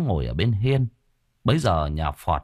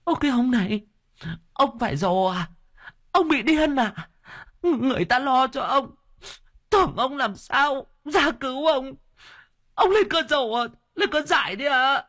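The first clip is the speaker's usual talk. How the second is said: wailing wife. A narrator's reading. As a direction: howl it out at him. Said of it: "bà bù lu bù loa".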